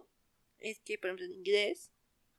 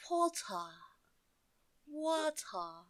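drawn-out, softer British 'water' with no R; British-accented 'water', crisp T and open ending; mock British accent; 4 semitones higher, 8 dB quieter, much slower than usual. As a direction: say 'water' in an exaggerated British accent, pausing between. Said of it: "Y luego me salen con".

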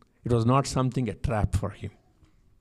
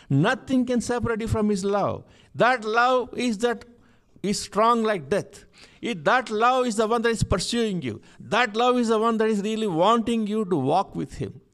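high-pitched, sustained proclamation; urgent, impassioned sermon peak; full-force preaching; 9 semitones higher, 3 dB louder than usual. Real, not acted. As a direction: declare it with a preacher's fervour.

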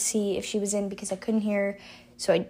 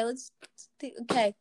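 usual speaking voice

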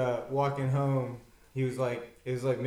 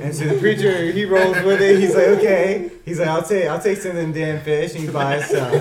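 laughing; 5 semitones higher, 11 dB louder than usual. Real, not acted. Telling punchline, laughing through it.

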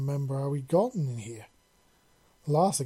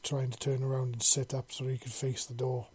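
plain reading voice

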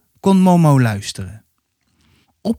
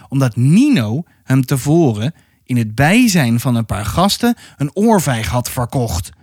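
ordinary delivery, nothing marked

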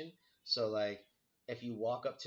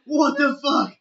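very high, loud re-enacted shock; startled outburst, far above usual pitch; shocked yell; 19 dB louder, slower than usual. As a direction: shriek it as if jump-scared. Retelling shock at mom speaking.

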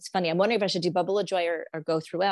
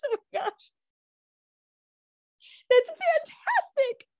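high, gasping disbelief and delight; squealing, astonished joy; overjoyed celebration; 11 semitones higher than usual. Acted. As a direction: gush as if thrilled for a friend. Acting out celebrating a friend's news.

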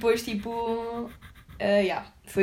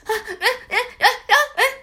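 high, stuttering gasps, choked up; can't get a word out, shaking; stammering; 12 semitones higher, 6 dB louder, slower than usual. Retold or imitated. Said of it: "estava toda gaga". (a gaguejar, engasgada de nervos)

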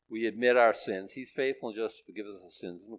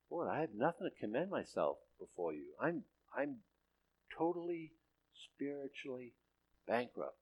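quiet confession; 10 dB quieter than usual. Pretend. Quiet voiced prayer of confession.

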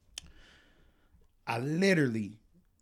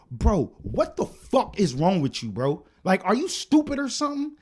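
high, exasperated outburst; snapping; 6 semitones higher, 5 dB louder than usual. Retold or imitated. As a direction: snap at him in disbelief, voice climbing. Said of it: "I just snapped".

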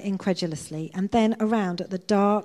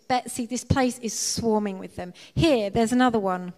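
understated wail, slightly raised; mild wail; 3 semitones higher, 4 dB louder than usual. A narrator's reading. Corrie complains to her sister about swarming fleas, mild wail. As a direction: wail it lightly in dismay. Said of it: "Corrie wailed".

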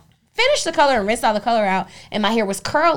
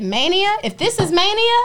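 giddy; 9 semitones higher than usual. Retold or imitated. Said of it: "I said".

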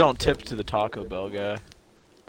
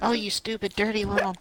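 high breathy mock moaning; hammed-up bedroom voice; mock sex moan; 11 semitones higher than usual. Mocking Kylie Jenner in bed.

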